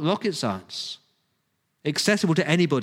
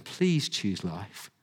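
soft, slow, low winsome appeal; 7 semitones lower, 6 dB quieter, much slower than usual. Shown as a demonstration. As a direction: coax them gently, drawing the words out slowly. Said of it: "a winsome".

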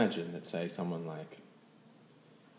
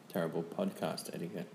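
ordinary delivery

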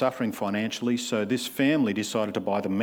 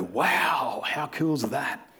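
mock amazement; 3 semitones higher than usual. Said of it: "we go".

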